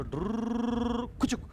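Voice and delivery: high, slow rolled whirr ending in a little click; playful trilled zooming noise; drawn-out whirring noise. Shown as a demonstration. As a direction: imitate a whirring motion with a trilled sound. Imitates noise of line being drawn.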